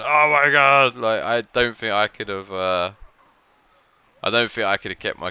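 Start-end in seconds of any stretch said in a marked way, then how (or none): 0.0-1.0 high, loud mock outcry; imitated exasperated scream. Commentator imagining the coach rolling his eyes, mock screaming. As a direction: cry it out like a player at wits' end. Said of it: "I reckon he was screaming".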